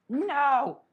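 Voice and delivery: high-pitched drawn-out "no" of dismay; dismayed cry. Real, not acted. Drawing teacher just broke a pencil tip, high dismayed cry.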